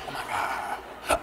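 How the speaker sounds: slow, whispered mock amazement; awed whisper. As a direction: breathe it out in secret awe. Furtive glimpse of fuzzy porn.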